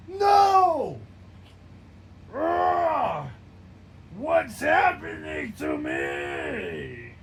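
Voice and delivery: loud, high anguished cries, slowing at the end; screams in agony. Acted. Hilda screams while turning into a monster, agonized cries. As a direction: cry out as your body transforms. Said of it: "Hilda screams in agony".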